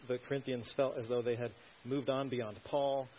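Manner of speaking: ordinary delivery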